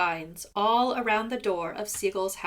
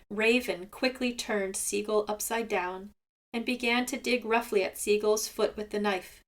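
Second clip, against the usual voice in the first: plain reading voice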